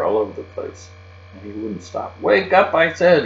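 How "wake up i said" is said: much higher, loud angry shout. Acted. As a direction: yell at the sleeping crickets. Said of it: "he shouted".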